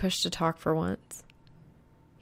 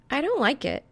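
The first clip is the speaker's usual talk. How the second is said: lightly voiced grumble, pitched up; grumbling girl; 8 semitones higher than usual. A narrator's reading. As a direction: grumble it like a worried friend. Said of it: "she grumbles".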